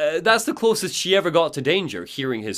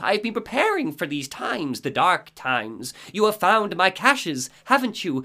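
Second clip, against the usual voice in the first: put-on high, odd old-lady voice; Cynthia voice; 6 semitones higher than usual. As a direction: greet him like a long-awaited guest.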